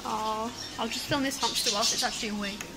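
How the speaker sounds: ordinary talk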